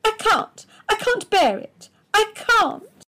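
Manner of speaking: high, loud tearful protest; a high, loud voice on the edge of crying; bursting into tears. A narrator's reading. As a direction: let it break as the tears come. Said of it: "burst into tears".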